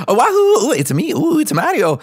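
high, loud Mario voice; hammed-up Mario greeting; squeaky Mario with a wahoo; Mario impression. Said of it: "he comes over and goes".

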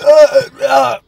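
strained loud groan; loud pained groans. Real, not acted. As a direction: groan through the burn. Reeling from the heat.

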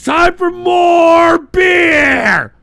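slow, loud and high; rowdy announcement of beer time; drawn-out hype shout. Real, not acted. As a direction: stretch it like an announcer.

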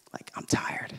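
whispered, worn out; tired whisper. Retold or imitated. Dad telling boys he's tired, whispered. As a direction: breathe it out like you're spent. Quoting himself tired at beach.

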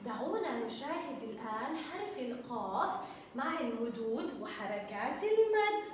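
ordinary talk

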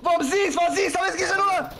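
high-pitched, insistent warning; frantic warning. Real, not acted. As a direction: warn the team urgently, pitch climbing.

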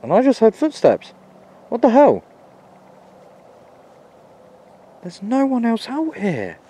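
high, loud and startled; spooked by footsteps; alarmed. Real, not acted.

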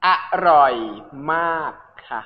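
broken into pieces with pauses; slow teaching repeat. Shown as a demonstration. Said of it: "เอาสอนอีกรอบหนึ่งได้ไหมคะ". (พูดช้าๆ แยกทีละคำ)

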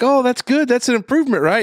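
mock upbeat wife voice; imitating the wife. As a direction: cheer him on like a hopeful wife. Wife voiced in retold TikTok.

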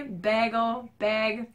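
bagel said her Midwest way. Shown as a demonstration. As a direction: bag with her own flat a. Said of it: "Do you say it like me".

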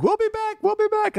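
very high mock sing-song. Skipper mocking guests who leave the line, high sing-song. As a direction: chirp it way up high like a promise. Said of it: "I was like".

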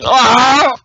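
short loud high "Ah"; a Jedi force-leap yell, hammed up; loud jokey Jedi yell; mock force yell. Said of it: "you don't hear a fucking".

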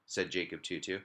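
plain reading voice

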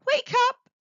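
high, loud desperate plea; begging. Acted. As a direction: beg her to wake up. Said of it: "he begged".

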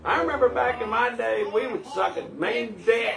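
high-pitched imitation of an old codger; old cartoon dude. Viewer recalling Paradise PD's old dude, high old-man impression. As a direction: pitch it way up like a reminiscing old-timer. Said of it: "And he had this voice".

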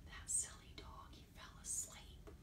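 breathy hushed whisper about the sleeping dog. Acted. Host whispering to the kids about the sleeping puppet. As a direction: whisper it so the dog stays asleep.

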